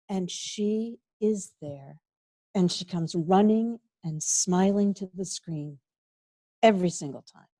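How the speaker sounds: ordinary talk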